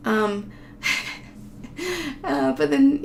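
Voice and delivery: ordinary delivery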